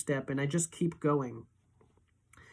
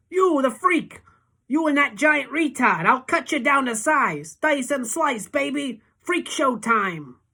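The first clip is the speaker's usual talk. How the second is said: much higher, loud, sneering thug voice, slowing at the end; menacing bully; 10 semitones higher, 7 dB louder, slower than usual. A narrator's reading. Gang leader threatening the two boys with a knife: loud sneering threat. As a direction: taunt them as the crowd's bully.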